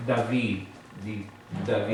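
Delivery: David as "da-VEE", final D barely sounded; soft final D. Shown as a demonstration. Teacher models David for the class, soft final D. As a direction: let the D of David melt toward "th". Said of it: "pronounce it just like you do this".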